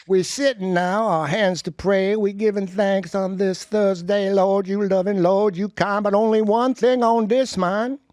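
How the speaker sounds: high, slow, hammed-up rap-style recitation with laughs; comic poem recital. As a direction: perform the poem like a street prayer, grinning.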